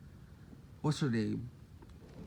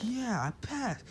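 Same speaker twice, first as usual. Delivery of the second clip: slow, pitched-up mock delight; happy; 8 semitones higher, 4 dB quieter, slower than usual. Deku learns he passed the test, happy. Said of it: "Deku's so happy".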